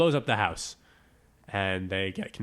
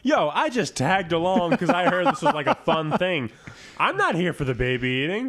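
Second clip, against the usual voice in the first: higher, louder laid-back bro voice; mock frat bro; 7 semitones higher, 6 dB louder than usual. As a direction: play the confused bro. Voicing a bro in crowd.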